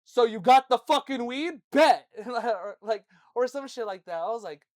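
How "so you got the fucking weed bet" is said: high, loud, good-natured dad shout; friendly yell. Retold or imitated. Dad yelling at his other son, loud but friendly. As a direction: bellow it at him in a nice way. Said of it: "He would yell at his other son, but, like, in, like, a nice way".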